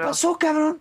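high, cheery hello to a buddy; hearty greeting. Retold or imitated. Greeting the friend arriving at the party, cheery. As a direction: greet your friend with a lift.

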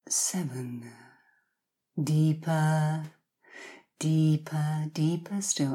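hypnotic countdown, each number drawn out; slow soothing countdown. Acted. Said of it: "you will hear me count down from ten".

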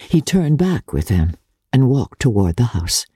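plain reading voice

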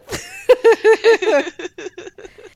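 high, loud burst of giggling; real giggle at the pun. Real, not acted. Host giggles at her co-host's pun, high and loud. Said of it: "That made me giggle".

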